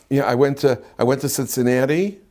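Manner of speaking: "Cincinnati" ending on a rising question tune; uptalk. Shown as a demonstration. Mimicking friends who end sentences on a question, rising on "Cincinnati". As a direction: let "Cincinnati" lift up like a question though it's a statement. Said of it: "end the sentence kind of on a question".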